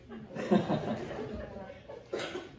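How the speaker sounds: high breathy laugh; embarrassed giggle. Real, not acted. Laughs at own nosebleed.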